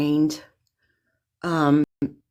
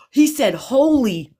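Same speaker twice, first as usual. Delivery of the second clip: high, louder amazed exclamation; astonished repeat; 9 semitones higher, 4 dB louder, slower than usual. Real, not acted. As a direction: blurt it out in disbelief. Startled by a word from spirit.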